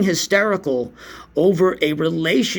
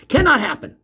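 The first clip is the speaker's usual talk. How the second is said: mock certainty; 9 semitones higher than usual. Real, not acted. Mock certainty about the knee.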